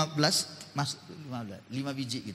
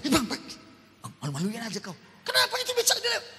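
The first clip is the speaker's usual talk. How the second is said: lightly voiced sibling scolding, fast, high at the end; a hint of an embarrassed sister hushing her brother; embarrassed younger sibling; 8 semitones higher, faster than usual. Younger sister scolds her brother for yelling in public. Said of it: "Adik saya bilang".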